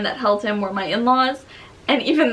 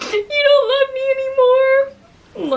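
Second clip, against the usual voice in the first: high, crying wail, slower; sobbing; 6 dB louder, slower than usual. Retold or imitated. To husband back from sister's birthday, sobbing. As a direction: wail it through tears, pitch high. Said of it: "I was sobbing and I was like".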